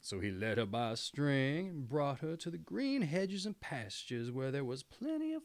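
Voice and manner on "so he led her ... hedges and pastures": ordinary delivery, nothing marked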